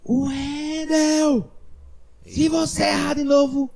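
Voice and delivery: high sharp shouted threat; angry warning. Retold or imitated. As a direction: snap at the actor who keeps forgetting lines. Director warning actor at rehearsal. (ameaça gritada ao ator no ensaio)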